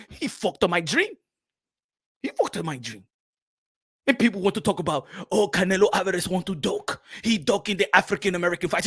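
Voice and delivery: put-on aggrieved grumble in African accent; Makabu. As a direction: boxer bemoaning his ruined chance. Makabu grieving lost fight.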